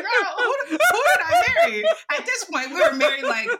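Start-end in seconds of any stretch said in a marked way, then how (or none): 0.0-1.8 high, laughing mock outrage; mock-horrified laughing question; laughing disbelief. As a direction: squeal it through laughter. Retold exasperated laughing question.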